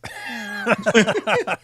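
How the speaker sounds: real high-pitched laugh; high burst of laughter. Real, not acted. Host laughing at the kid's reply, real. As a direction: crack up at it.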